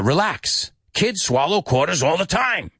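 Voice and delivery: put-on high-pitched old man's reassurance; Grandpa. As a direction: put on a high voice and brush off the worry. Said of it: "He was very old in this movie".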